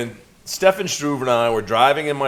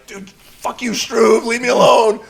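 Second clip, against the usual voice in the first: higher, building to louder; desperate driver snapping at mocking friend, rising; mock desperate snap; 9 semitones higher, 4 dB louder than usual. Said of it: "and I'm like".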